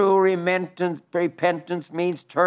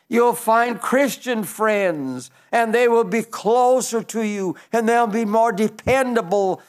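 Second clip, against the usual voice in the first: raised, fervent pulpit appeal; preaching at full force; 4 semitones higher than usual. Acted. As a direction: lift the voice and plead with the congregation.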